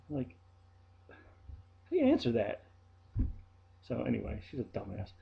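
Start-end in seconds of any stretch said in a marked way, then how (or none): 1.1-2.6 incredulous. Real, not acted. Baffled by the remark.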